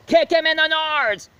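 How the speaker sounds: put-on high, loud yelling kid; kid's shout. The leader yelling at the horse, high loud shout. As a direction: shriek it like a panicked kid. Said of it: "the leader was like".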